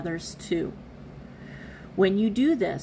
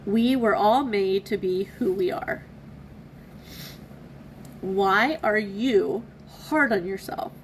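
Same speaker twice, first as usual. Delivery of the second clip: ordinary talk, nothing marked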